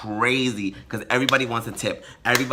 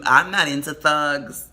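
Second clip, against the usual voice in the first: normal talking voice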